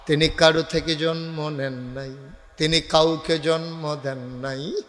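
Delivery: usual speaking voice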